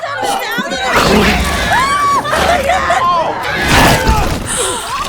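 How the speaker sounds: high shrieking laugh, loud; loud burst of laughter. Real, not acted. Laughing at fight scene.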